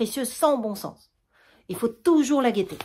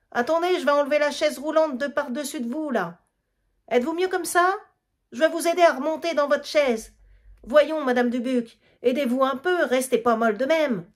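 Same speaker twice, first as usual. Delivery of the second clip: fussing Thérèse; 5 semitones higher than usual. Acted. Thérèse fussing over the old woman.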